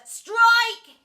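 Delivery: big high drawn yell. A narrator's reading. Third kid joins the imaginary game, yelling. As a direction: yell it like an umpire. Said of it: "yelled a third".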